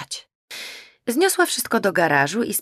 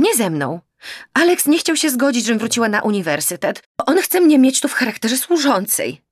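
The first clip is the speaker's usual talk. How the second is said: raised, loud, desperate protest; upset outburst; 5 semitones higher, 6 dB louder than usual. Acted. Daughter protests to disbelieving parents, loud and desperate. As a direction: pour it out, indignant and hurt.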